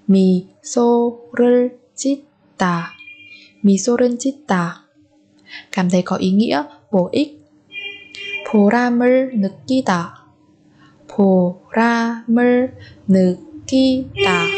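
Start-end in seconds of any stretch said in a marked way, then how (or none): 0.1-2.9 slow 미소를 짓다 in separate beats; syllable by syllable. Shown as a demonstration. Teacher splits phrase by syllable. 11.1-14.5 보람을 느끼다 with gaps between syllables. Shown as a demonstration. Teacher splits phrase by syllable.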